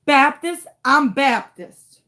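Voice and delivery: ordinary talk, nothing marked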